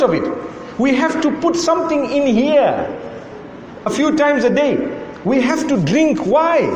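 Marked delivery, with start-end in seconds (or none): none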